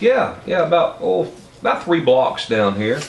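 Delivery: high, friendly voice for the garage owner. Retold or imitated. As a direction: lift the pitch to play the garage owner. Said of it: "He said".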